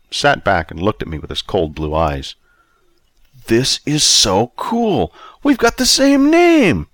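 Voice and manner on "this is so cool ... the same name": excited squeal. Acted. Young Bobby squealing to his older self with delight. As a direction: voice leaps high and loud with glee.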